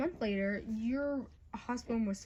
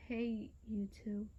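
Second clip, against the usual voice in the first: ordinary talk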